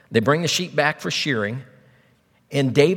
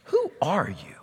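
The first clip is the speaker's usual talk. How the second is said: dismissive put-down; 5 semitones lower, 6 dB quieter than usual. Shown as a demonstration. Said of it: "if you ever just wanna get somebody".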